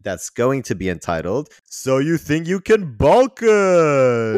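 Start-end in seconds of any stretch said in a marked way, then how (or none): 1.6-4.4 loud, high, drawn-out announcer shout; quiz title fanfare. Acted. Host announcing the quiz title to listeners, a loud drawn-out shout. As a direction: hype up the quiz name like a TV show.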